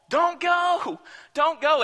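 begging plea. Retold or imitated. Friends begging Paul to stay, high and pleading. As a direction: beg him not to leave.

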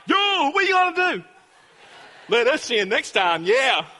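high, loud mock bravado; hammed-up triumphant challenge; cocky taunting shout. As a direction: crow it at a beaten bully. Acting cocky boys taunting the bully.

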